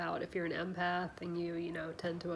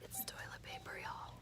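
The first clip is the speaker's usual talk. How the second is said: hushed, breathy whisper; excited whisper; 10 dB quieter than usual. Real, not acted. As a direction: whisper it like a secret treasure. Gleefully sharing a secret find.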